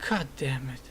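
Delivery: under-the-breath defeated whisper; whispered curse. Retold or imitated. After the interviewer rejects his pitch, a whispered curse. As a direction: mutter it to yourself, crushed.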